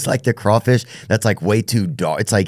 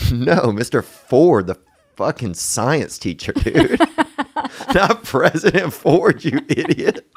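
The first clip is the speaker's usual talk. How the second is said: slow, mock-exasperated janitor rising higher at the end; exasperated correction; 7 semitones higher, slower than usual. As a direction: correct a clueless coworker, drawn out and incredulous. Janitor retold correcting his colleague.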